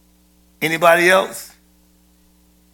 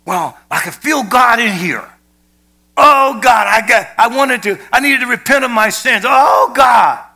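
mock churchgoer at revival; 7 semitones higher, 7 dB louder than usual. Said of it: "revival to us is what happens in the church service".

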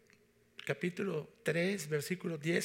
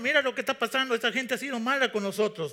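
Jonah complains high and loud; angry Jonah; 7 semitones higher, 7 dB louder than usual. Acted. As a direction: loudly whine to the Lord as upset Jonah. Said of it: "dice Jonás". (Jonás enojado, agudo y fuerte, quejándose al Señor)

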